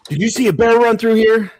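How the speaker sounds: high, loud questioning lion voice; searching lion. Acted. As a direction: call it out as the lion on the hunt. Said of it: "the lion comes up, and he's like".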